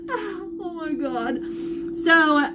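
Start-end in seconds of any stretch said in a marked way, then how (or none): 0.1-1.4 choked up. Real, not acted. Overwhelmed by good news.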